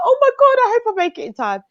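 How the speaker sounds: high, flustered exclamation; retold panic. Panellist retelling her dash to the show, high-pitched mock panic. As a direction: act out a sudden rush of worry.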